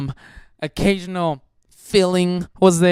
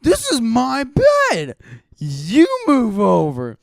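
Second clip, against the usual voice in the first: higher, slow, stubborn mock voice; Kyle refusing; 7 semitones higher, 3 dB louder, slower than usual. Kyle defending his bed, slow and stubbornly high. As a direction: plant your feet and drag each word out. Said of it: "He's like".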